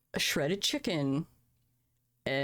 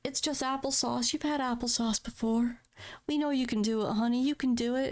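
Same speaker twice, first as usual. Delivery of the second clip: higher, sing-song encouragement; mock-sweet nurse calling her honey; cooing nurse; 7 semitones higher than usual. Nurses cheering her through applesauce, high cooing encouragement. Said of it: "they're like".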